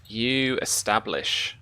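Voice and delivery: ordinary talk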